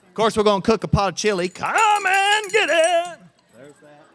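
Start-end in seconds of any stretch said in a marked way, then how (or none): 1.5-3.2 long high call, slow and drawn out; drawn-out chuck call. Acted. Camp cook calling the kids to chili, slow high holler. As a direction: holler it like a camp cook ringing the dinner bell.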